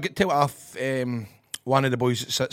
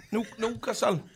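higher-pitched practice at Geordie; attempted Geordie accent; 8 semitones higher, 4 dB quieter than usual. Shown as a demonstration. Guest rehearses the city name, attempted Geordie accent. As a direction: try out the Geordie sound. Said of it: "give me a sentence to say Newcastle".

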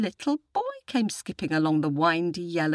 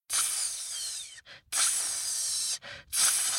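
soft, long snake hisses; cobra hiss; 8 dB quieter, much slower than usual. A narrator's reading. Cobra slithers up to the boy, soft hissing. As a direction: hiss three times like a snake.

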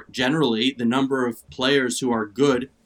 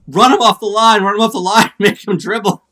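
higher, louder retold courtside yelling; imitating a yell; 7 semitones higher, 9 dB louder than usual. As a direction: echo a commentator's urgent shout. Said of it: "Poor Debbie Antonelli was yelling".

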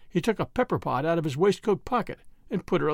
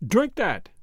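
mildly raised, abrupt command; loud, sudden voice; 3 semitones higher than usual. A narrator's reading. Priest giving Cray the emetic, sudden raised voice. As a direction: order it suddenly, a touch louder. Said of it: "in a new, loud, and sudden voice".

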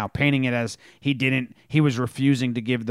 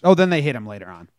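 ordinary talk, nothing marked

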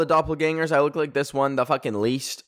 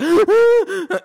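loud high sharp gasp of awe; starstruck gasp; 10 dB louder than usual. Retold or imitated. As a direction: gasp loudly, stunned to see your idols. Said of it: "I'm like".

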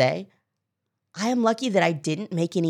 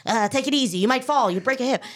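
imitating a fretful old therapist, higher-pitched; lightly acted worried warning, pitched up; mock nervous; 8 semitones higher, faster than usual. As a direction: fuss over a patient as if they were fragile. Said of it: "he was, like, very nervous".

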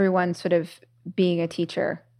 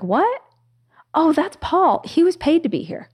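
high, light, breezy guru voice; lightly voiced guru; 9 semitones higher, 3 dB louder than usual. Guru answering his worried assistant, high and breezy. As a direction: toss it off like a serene guru. Guru's calm punchline in story.

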